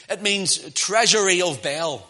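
usual speaking voice

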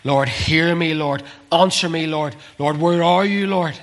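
high, building plea to God; crying out. Retold or imitated. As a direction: call out urgently, pitch climbing. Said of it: "He's crying on to God".

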